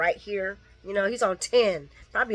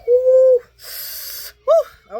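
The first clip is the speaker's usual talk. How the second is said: loud high woo; 9 dB louder than usual. Real, not acted. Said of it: "venting outburst".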